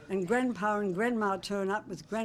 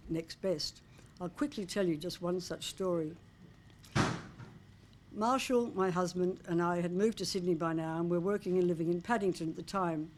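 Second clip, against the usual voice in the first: normal talking voice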